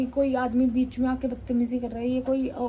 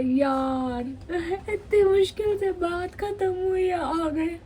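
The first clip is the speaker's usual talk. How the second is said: mock whimper, pitched up; imitating her whiny self; 6 semitones higher, slower than usual. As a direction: retell your own near-tearful complaint, hammed up. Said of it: "और मैं ऐसे". (नकली रुआँसी आवाज़ में शिकायत)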